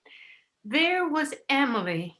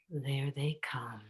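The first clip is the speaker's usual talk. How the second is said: slow hushed whisper; 7 semitones lower, 9 dB quieter, slower than usual. Acted. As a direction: warn softly as they come in. Guests arriving, hushed warning.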